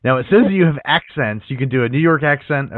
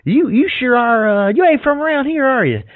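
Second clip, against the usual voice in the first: put-on Southern accent; 11 semitones higher than usual. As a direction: play a nosy Southern local, voice pitched up. Answers her Southern demo in kind.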